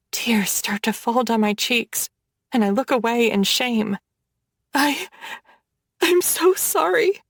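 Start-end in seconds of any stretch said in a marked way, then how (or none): 4.7-7.2 high, choked apology; choking up. Acted. The heroine apologizes to Gavin while choking up. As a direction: force it out past a tight throat. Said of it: "I choke".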